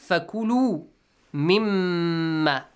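measured chant, lingering on the doubled meem in "مِمَّا"; held doubled meem. Shown as a demonstration. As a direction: recite with the mm of "مِمَّا" held as a nasal hum. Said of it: "nun mushaddad. Here we have mim mushaddad".

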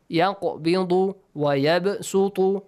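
يَقْبِضُ وَيَبْسُطُ with a bounced echo on q and b; qalqala echo. Shown as a demonstration. Teacher showing the echoing sound, q and b bounced in يَقْبِضُ وَيَبْسُطُ. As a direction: pop the stopped q in يَقْبِضُ and b in وَيَبْسُطُ. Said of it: "echoing sound".

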